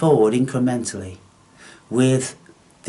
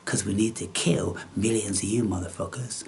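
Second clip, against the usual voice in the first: ordinary delivery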